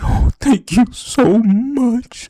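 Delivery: high, very slow, sugary thanks; mock grateful gushing. The relieved employee thanking her manager, mock gushing. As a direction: gush it out like an overgrateful teen. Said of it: "fucking praising me".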